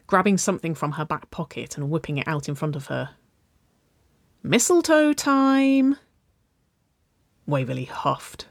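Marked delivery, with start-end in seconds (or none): 4.4-6.0 high, loud, long drawn-out singsong cry; gleeful announcement. A narrator's reading. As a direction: sing it out to tease a little sister. Teen gleefully announces mistletoe to sister.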